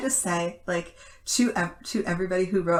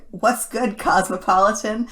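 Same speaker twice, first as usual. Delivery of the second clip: laughing call-out; 4 semitones higher, 9 dB louder than usual. Real, not acted.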